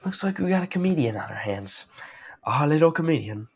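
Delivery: hammed-up menacing condescension; ominous. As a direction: toy with them like a villain. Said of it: "ominous as heck".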